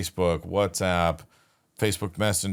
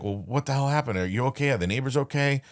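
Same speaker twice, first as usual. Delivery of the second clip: higher-pitched, retold alarmed questions; 7 semitones higher than usual. Telling the neighbor on the phone about the fire, a retold alarmed higher voice. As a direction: replay the jolt of hearing the news, voice up high. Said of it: "So I'm like".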